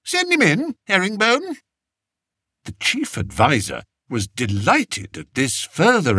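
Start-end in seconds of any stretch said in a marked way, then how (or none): 0.0-1.6 raised, lightly voiced young king; kingly voice. King telling his adviser to send the shepherd in, higher voice. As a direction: play the king granting an audience.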